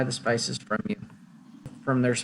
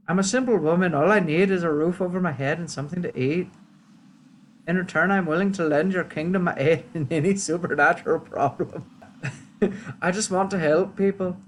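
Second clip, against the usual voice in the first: higher, lilting put-on accent; helpful witch; 5 semitones higher than usual. Witch offering the kingdom aid, helpful accented voice.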